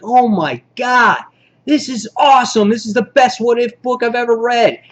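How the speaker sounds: high, re-enacted thrilled astonishment; amazed outburst. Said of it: "just surprised the shit out of me".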